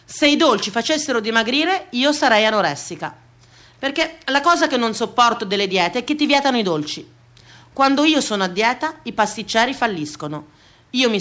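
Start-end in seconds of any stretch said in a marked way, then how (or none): none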